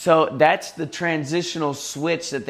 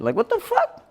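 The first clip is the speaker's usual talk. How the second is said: incredulous squeak. Real, not acted. Exasperated at people's assumption.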